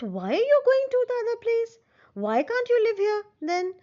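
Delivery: fish's question. Acted. Teacher voicing the fish's question.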